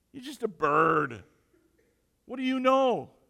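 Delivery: high, loud dismissive outburst; acted indignant disbelief, voice jumping up; scoffing burglar. Burglar scoffing at a talking bird, loud and indignant.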